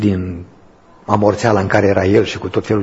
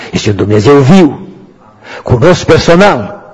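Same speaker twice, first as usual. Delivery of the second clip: preaching at full force; 6 semitones higher, 7 dB louder than usual. Real, not acted. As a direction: proclaim it like a testimony. (mărturisire cu putere)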